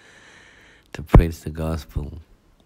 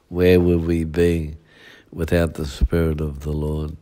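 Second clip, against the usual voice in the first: ordinary talk, nothing marked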